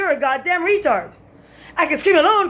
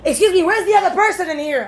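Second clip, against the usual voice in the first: lightly acted cop demand, higher pitch; retold cop questioning; 5 semitones higher than usual. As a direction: imitate a cop's insistent question. Retold cops barging in questioning.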